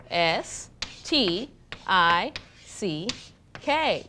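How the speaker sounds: "S T I C K" letter by letter, slow; spelling out letters. Shown as a demonstration. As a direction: spell "S T I C K" slowly, one letter at a time.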